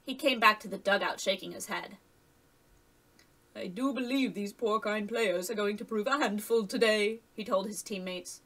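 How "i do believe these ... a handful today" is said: lightly put-on voice for a teammate; a hint of a character voice for Toad. A narrator's reading. Toad's dialogue in narrated story.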